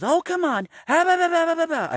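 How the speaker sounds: shrill-free but very high, loud imitation of a woman calling dogs; loud dog lady. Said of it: "really loudly".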